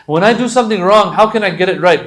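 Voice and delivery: eager questioner. Retold or imitated. Eager student asks the imam.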